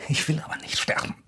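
desperate whispered plea; frightened whisper. Acted. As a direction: whisper it, terrified. Hostage pleads for life.

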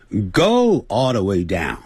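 slow, careful English model sentence; slow model reading. Shown as a demonstration. Teacher reading the sentence slowly for students. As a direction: read it slowly for the class.

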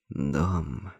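low, hushed single word; whispered. A narrator's reading. Neil tries the word while looking at his key, hushed. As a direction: say it softly, almost to yourself. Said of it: "прошептал он".

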